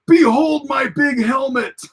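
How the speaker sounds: high, slow hammed-up shout; triumphant silly Pakled yell; mock Pakled boast. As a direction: bellow it like a dim alien crowning himself. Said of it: "he goes".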